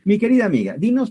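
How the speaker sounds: ordinary talk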